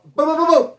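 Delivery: high, loud mouth-made booms; punch sounds. Retold or imitated. Imitating Pony's fists landing, loud high booms. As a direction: punch out each boom like a hit.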